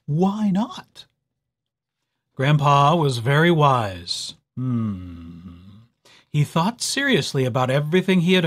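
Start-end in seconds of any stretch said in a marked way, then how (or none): 0.1-1.1 unvoiced hushed question; whisper. Grandpa asks, whispered. 4.5-5.6 deep pondering hmm; thoughtful low hum. Acted. Grandpa thinking deeply.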